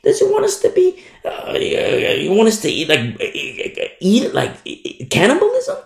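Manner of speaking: high-pitched, stammering disbelief; mock the clueless with squeaky, halting questions; baffled unbelievers. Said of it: "those who are blind and deaf says".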